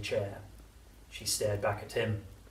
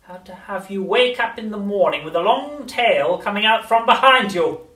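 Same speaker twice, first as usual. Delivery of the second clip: throaty witch whisper; 11 semitones higher, 14 dB louder than usual. A narrator's reading. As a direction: lean in and menace. Grandma menacing.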